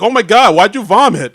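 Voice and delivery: high, loud horrified outcry in imitation; mock horrified. Podcaster acting out studio execs seeing vomit, mock horrified. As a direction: play up the appalled shock.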